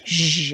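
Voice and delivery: long buzzing French J, "zh"; whizzing car noise. Shown as a demonstration. Teacher demonstrates French J as a fast car, whizzing. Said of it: "sound of the fast car whizzing by".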